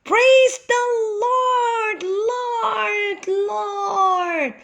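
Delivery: high, fading echo voice repeating the last word; a funny echo fading away; cave echo. Acted. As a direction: bounce it back like a cave echo. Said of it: "said a funny voice".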